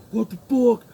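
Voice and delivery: high, loud mocking shout; hammed-up outraged boss; mocking yell. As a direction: imitate the boss barging in. Scott yelling on opening garage.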